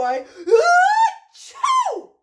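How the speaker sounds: very loud, high, drawn-out sneeze; explosive mock sneeze. Gerald cannot hold it in, explosive mock sneeze. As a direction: build up and explode.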